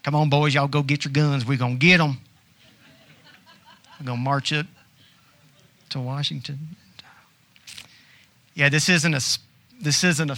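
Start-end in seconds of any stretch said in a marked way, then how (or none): 0.0-6.8 hammed-up Southern rabble-rouser; rally the boys in a mock Southern accent. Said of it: "It's just always a Southern".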